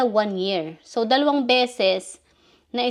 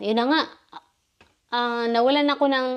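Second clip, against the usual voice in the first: ordinary delivery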